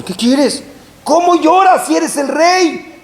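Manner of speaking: high, slow, scolding question; stern rebuke. Retold or imitated. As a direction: demand an answer from the crying brother.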